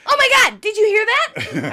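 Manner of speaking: high, loud startled gasp of a line; startled exclaim. Retold or imitated. Coworker hears the humming and bursts out, startled. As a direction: act like you just heard a ghost.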